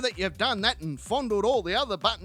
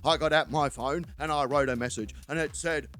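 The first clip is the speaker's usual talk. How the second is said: lower, deadpan mate telling a story; lightly voiced blokey friend, matter-of-fact; a hint of a dull-witted mate; Terry; 9 semitones lower than usual.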